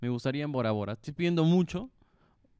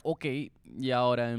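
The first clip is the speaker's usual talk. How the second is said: usual speaking voice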